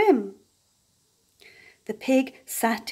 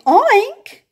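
much higher, loud squeal; squealing oink; 11 semitones higher, 9 dB louder than usual. A narrator's reading. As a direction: squeal like a delighted pig. Pig's louder oink.

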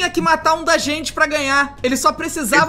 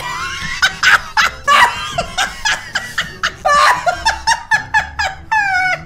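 can't stop laughing; long high laugh. Real, not acted.

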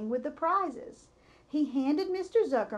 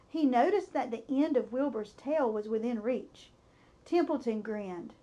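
ordinary delivery, nothing marked

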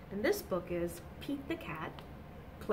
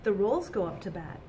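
bright, lively storybook reading; reading to children; 4 semitones higher, 4 dB louder than usual. A narrator's reading. Teacher reading a picture book to children, animated. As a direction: read it out to the little ones with energy.

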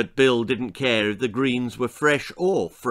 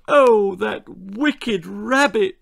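high, slow hammed-up wail; mock lament, louder; wailing mother; 8 semitones higher, 5 dB louder, slower than usual. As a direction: wail like a distraught mum. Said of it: "she wailed".

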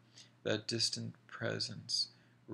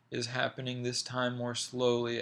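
ordinary delivery, nothing marked

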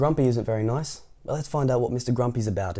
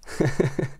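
short closed-mouth giggle; mischievous chuckle; 7 semitones higher than usual. Acted. Mister Tickle likes the plan, chuckling mischievously. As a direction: chuckle like a schemer. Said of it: "Mister Tickle's like".

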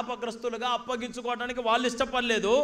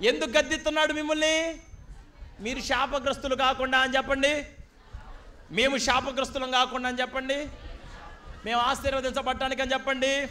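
loud, higher, driving sermon voice; fiery preaching; 4 semitones higher, 6 dB louder than usual. Real, not acted. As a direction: preach it at full force. Preaching at full force.

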